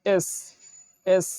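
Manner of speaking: এস with a sharp s; teacher gives এস a clear hissing s; English S. Shown as a demonstration. Teacher models the letter S for learners. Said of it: "এটা হবে".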